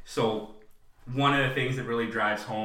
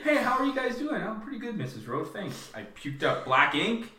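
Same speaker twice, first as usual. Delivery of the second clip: high, falsely breezy small talk; mock cheerful greeting; 10 semitones higher than usual. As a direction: act fine in a high put-on voice. Acts cheerful chat with Mrs. Roach.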